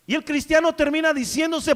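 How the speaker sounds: loud, driving sermon delivery; impassioned pulpit preaching; full-force preaching. Acted. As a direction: preach it hard to the congregation.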